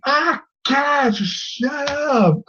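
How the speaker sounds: high, slowing exasperated groan; fed up with the screaming. Acted.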